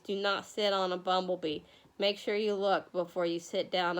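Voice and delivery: low, soft, casual warning; quiet reminder. Real, not acted. Teacher reminds named pupils about bumblebees, quiet and low. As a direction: say it gently, running the words together.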